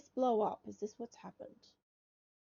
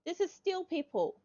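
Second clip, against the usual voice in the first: much higher, louder burst of real discovery; excited exclamation; 10 semitones higher, 4 dB louder than usual. Real, not acted. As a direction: shout it out like you just struck gold. Finally finds missing steel.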